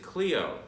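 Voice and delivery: Clio said as KLEE-oh; standard pronunciation. Shown as a demonstration. Guide telling viewers how they would pronounce it, Clio as KLEE-oh. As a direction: say Clio with an "ee" in the first syllable. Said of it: "You would pronounce it".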